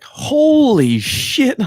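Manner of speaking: very high, slow stretched-out exclamation; drawn-out squeaky holy shit; imitating Bob Memory. Friend imitates a comedian's famous catchphrase, high and slow. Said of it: "the way he says it when he's like".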